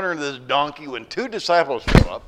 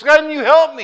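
high, loud desperate plea; loud pleading; 13 semitones higher, 13 dB louder than usual. Acted. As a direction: beg loudly from the branch. Hanging man pleading.

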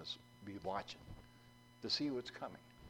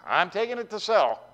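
loud, higher call back; yelling back; 9 semitones higher, 11 dB louder than usual. Acted. John calling back to the pastor about the horse, loud. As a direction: shout the answer back up to the porch. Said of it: "John yells back".

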